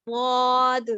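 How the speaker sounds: ضاد the full heavy way, mouth filled; correct zwad demo. Shown as a demonstration. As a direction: say واد heavy and full, voice rising. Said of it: "پر کرنا ہے". (ضاد کو بھاری اور پُر آواز میں ادا کریں)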